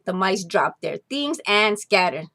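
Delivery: usual speaking voice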